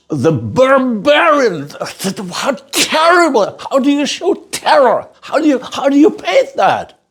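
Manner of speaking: high, anguished cry of disbelief; anguished outburst. Real, not acted. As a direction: pitch way up, agonised over how to show it. Agonised outburst over painting terror.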